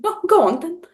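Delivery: put-on British accent. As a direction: give in with a high, very British lilt. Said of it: "you sounded very British right then".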